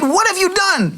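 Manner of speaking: put-on a parent catching the kids red-handed; shocked parent exclamation. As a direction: parent exclaiming "What have you done?" at the kid who poured milk. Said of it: "and you say to the kid".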